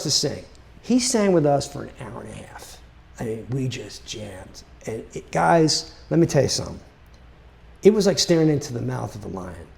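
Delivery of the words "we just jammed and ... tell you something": ordinary delivery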